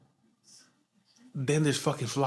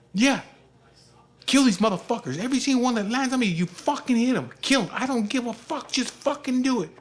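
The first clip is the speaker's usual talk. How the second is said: high, loud mimicked ranting orders; heated insistence; 10 semitones higher, 4 dB louder than usual. Father urging son to kill flies, heated insistence. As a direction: imitate frenzied urging.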